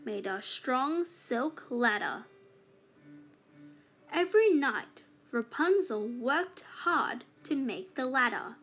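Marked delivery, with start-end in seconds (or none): none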